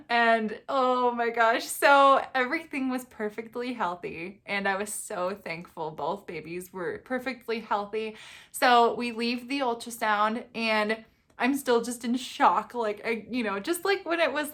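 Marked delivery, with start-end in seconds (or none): none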